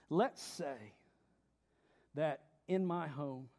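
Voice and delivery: ordinary delivery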